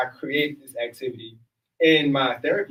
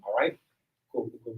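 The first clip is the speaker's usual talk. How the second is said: ordinary talk, nothing marked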